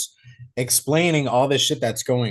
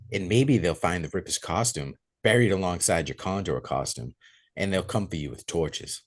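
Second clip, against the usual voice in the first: lower, softer sinister lean-in; creepy lean-in; 7 semitones lower, 6 dB quieter than usual. Retold or imitated. Retelling the killer leaning in on Bug, low creepy murmur. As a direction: lean in close and menace him softly. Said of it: "weird, creepy fucking thing where, like, he leans in".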